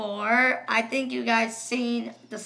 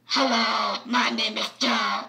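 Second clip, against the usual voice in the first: slow, lowered robotic voice; voice changer, third setting; 3 semitones lower than usual. Shown as a demonstration. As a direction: introduce yourself through a toy voice changer. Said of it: "The third one is this one".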